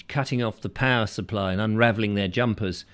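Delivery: ordinary talk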